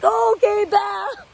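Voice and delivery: high, docile sheep bleat, lightly done; meek sheep. Retold or imitated. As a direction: play a meek follower who baas. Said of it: "And everybody's like".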